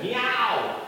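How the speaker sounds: high, swooping miaow; drawn-out playful meow; cat meow. Acted. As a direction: mew out like a storybook cat. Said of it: "The cat said".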